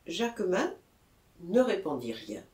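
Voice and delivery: plain reading voice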